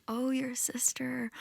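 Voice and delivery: soft high whisper, a gentle echo back. Acted. Bird creatures echoing the goddess's grief, soft whispered echo. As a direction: hush it back to her as a witness. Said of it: "And they say".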